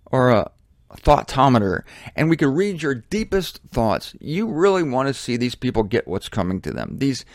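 ordinary talk, nothing marked

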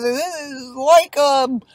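high, hesitant acted quote; John's voice. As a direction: play John groping for an explanation. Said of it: "So he says".

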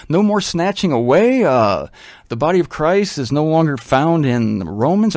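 ordinary delivery

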